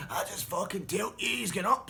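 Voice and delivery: high, loud insistence; insistent shout. Retold or imitated. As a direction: overrule him loudly. Bully insists.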